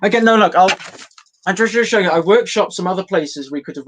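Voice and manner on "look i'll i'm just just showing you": ordinary talk, nothing marked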